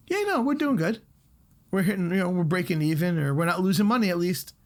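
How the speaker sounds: high, smug mock of a complacent owner. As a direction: sneer at the good-enough crowd in a lifted voice. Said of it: "that good enough mentality".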